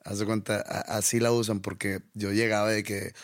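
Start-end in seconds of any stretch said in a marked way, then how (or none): none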